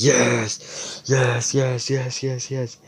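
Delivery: gleeful yeses, like cheering inside your head; eager inner scream. Retold or imitated. Luck screaming in his head, imitated eager yes. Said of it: "screaming in his head".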